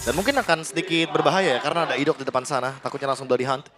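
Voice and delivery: usual speaking voice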